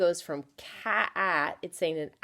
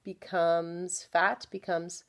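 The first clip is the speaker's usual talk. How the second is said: ordinary talk, nothing marked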